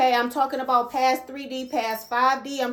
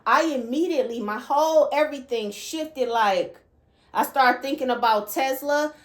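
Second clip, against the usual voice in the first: ordinary delivery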